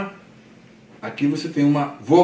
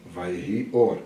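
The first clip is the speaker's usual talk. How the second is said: narrator voice; 7 semitones lower, 8 dB quieter than usual. Shown as a demonstration. Teacher returning to narrator for students, low and soft. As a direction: lower and softer narrator, understated. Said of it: "Volta pro narrador".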